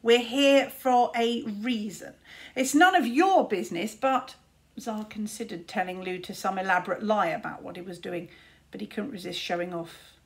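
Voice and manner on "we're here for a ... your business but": put-on slow, louder, boastful kid wizard; proud young wizard. A narrator's reading. Tsar brushing off his brother, loud and proud. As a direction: puff up and brag.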